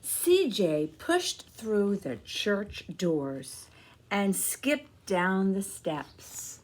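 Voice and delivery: put-on slow, gentle read-aloud for kids; storybook narration. A narrator's reading. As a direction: tell the story to a room of children. Reading picture book to children.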